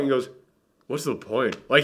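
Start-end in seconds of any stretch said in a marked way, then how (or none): 0.9-1.6 quiet, hopeless, much softer; defeated. Retold or imitated. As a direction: let it fall away, giving up. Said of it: "he just has, like, this tone to him".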